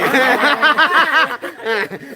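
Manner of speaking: high cackling laugh; hammed-up villain cackle; evil laugh. Shown as a demonstration. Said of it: "Ayo, ketawa jahat dulu".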